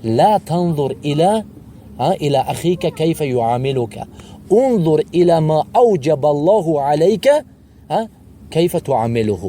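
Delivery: slow, deliberate recitation of the sheikh's Arabic words; measured Arabic recitation of a scholar's words; slow Arabic quotation. Retold or imitated. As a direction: draw out each Arabic word like a quoted saying. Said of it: "همین شیخ چی گفت یعنی؟".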